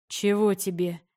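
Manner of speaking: curt, ungracious question; unfriendly. A narrator's reading. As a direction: ask it with cold rudeness. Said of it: "не очень любезно вопросил".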